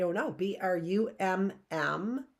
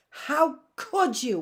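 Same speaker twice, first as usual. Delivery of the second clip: ordinary delivery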